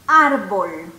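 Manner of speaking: árbol said out loud as if tree meant three; loud mock mistake, árbol; wrong, said as tree. Shown as a demonstration. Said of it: "Muchos de ustedes ahí están diciendo". (decir árbol como error)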